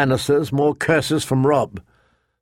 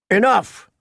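sharp raised call to stop the fight; 8 semitones higher than usual. Acted. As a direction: shout to end the sparring. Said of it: "Sir Roderick called out".

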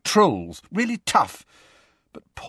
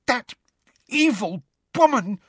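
high, outraged gasp; so enraged it's hard to talk; gasping with rage; 13 semitones higher than usual. A narrator's reading. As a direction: choke it out in high-pitched fury. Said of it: "gasped Hermione".

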